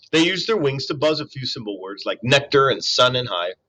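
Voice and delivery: plain reading voice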